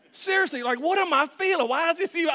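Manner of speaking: very loud, high-pitched outburst; worked-up rant at full volume. Real, not acted. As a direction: erupt in exasperated disbelief. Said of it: "I still get worked up at that".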